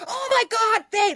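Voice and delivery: very high, loud shriek of recognition; piercing excited mock scream; imitated fan scream. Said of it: "like, screaming".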